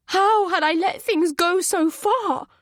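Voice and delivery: high, loud anguish in the narration. A narrator's reading. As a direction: let panic crack through. Anger and fear surging.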